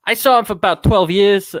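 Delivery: lightly put-on tough guy; changed character. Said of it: "I'm gonna radically change character".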